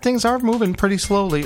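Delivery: ordinary talk, nothing marked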